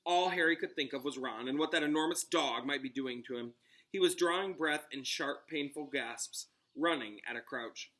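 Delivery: usual speaking voice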